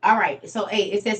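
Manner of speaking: usual speaking voice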